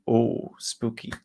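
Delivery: slow, hammed-up ghost-story tone; mock spooky voice. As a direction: drag it out like a cheap horror intro. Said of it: "make it feel a bit like".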